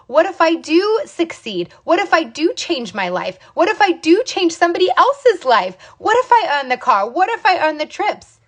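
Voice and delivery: high-pitched, eager string of possibilities; hopeful what-ifs. Acted. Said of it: "That's the wrong what ifs".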